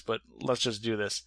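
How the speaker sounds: normal talking voice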